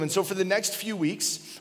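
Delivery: normal talking voice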